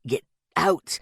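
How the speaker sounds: low, effortful gasp. A narrator's reading. Dying Louis urging friends to leave, low gasp. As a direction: force the words out, deep and strained. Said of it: "he gasped".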